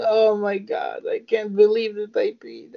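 hammed-up woman, pitched high; high mock shocked woman. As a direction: gasp it out high.

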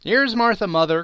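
put-on high, loud children's cry; excited cry. A narrator's reading. Young Cratchits greeting their sister, loud excited cry. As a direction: cry it out gleefully like young kids. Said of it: "cried the two young Cratchits".

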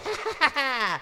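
high hammy cackle; short gleeful high cackle; ghoulish cackle. Acted. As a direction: let out a villainous horror-host laugh. Horror host cackles at own joke.

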